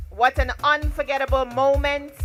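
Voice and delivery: usual speaking voice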